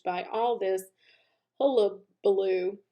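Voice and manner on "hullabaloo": usual speaking voice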